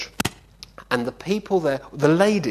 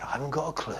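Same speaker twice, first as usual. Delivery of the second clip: whispered, hushed confession; 8 dB quieter, much faster than usual. Retold or imitated. Pastor whispering he has no clue to the preacher. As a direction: breathe it like a secret.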